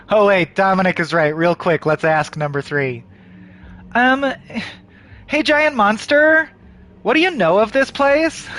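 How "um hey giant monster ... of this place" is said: mock polite question. Player addresses giant monster.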